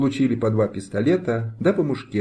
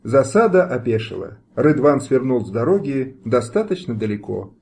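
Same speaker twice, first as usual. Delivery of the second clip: ordinary delivery, nothing marked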